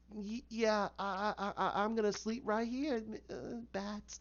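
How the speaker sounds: mock woozy surrender, fading out; imitated dazed woman. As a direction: stammer weakly as if about to pass out. Beaten Harley gives up, dazed.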